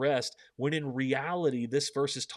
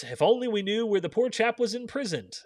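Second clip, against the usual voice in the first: character voice; 10 semitones higher, 6 dB louder than usual. A narrator's reading. Voicing a Narnia character.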